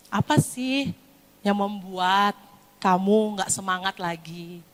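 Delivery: sit down and ask gently, unhurried; gentle parent. Acted. Parent sitting down with a discouraged child, gentle. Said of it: "Pernah nggak diajak duduk?".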